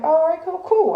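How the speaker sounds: sarcastic "oh, all right" jumping way up; high mock agreement. Recalling Krishan's advice, a high sarcastic "cool". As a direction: voice shoots high in mock surprise. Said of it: "So I'm like".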